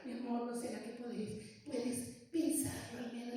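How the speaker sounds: soft coaxing wife's voice, slowed; a mild take on a wife interceding; imitating pleading wife. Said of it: "La mamá entonces viene como intercesora".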